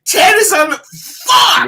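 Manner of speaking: high, loud exasperated yell; outraged shout. Retold or imitated. Host yelling as Ted joins the call, loud and exasperated. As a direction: blurt it out in disbelief. Reliving anger at the presser.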